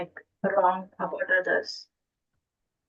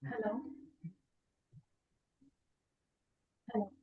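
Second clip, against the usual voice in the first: barely audible; 17 dB quieter, faster than usual. Real, not acted. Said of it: "Your voice is very low".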